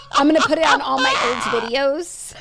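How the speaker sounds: laughing hard, high and loud. Real, not acted. Laughing at her own plan to tag old videos. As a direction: crack up mid-sentence.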